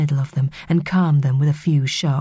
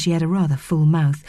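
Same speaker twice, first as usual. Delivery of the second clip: usual speaking voice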